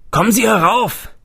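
loud, high call from upstairs. A narrator's reading. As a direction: shout down to a visitor below. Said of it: "rief ein Mann von oben".

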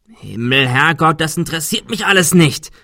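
loud, high outburst; exasperated shout. A narrator's reading. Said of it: "schrie Adrian".

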